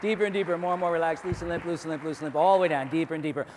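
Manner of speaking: soothing hypnotist chant; hypnotic induction. Acted. Stage hypnotist deepening the trance, soothing chant. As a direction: lull them down with rhythm.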